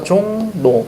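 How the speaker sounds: 종로 said slowly as "jong-no", the ㄹ turned into n; nasalized pronunciation. Shown as a demonstration. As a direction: draw out 종로, sounding the second syllable 노. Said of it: "얘 어떻게 바뀝니까".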